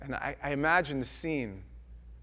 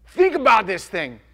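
slow, louder, higher demand; loud challenge; 7 semitones higher, 15 dB louder than usual. Retold or imitated. John the Baptist challenging the Pharisees, loud. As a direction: call it out to make them think. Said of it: "and he says".

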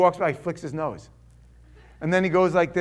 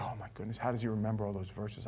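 hushed amazement; 8 semitones lower, 11 dB quieter, faster than usual. Retold or imitated. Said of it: "I'm blown away".

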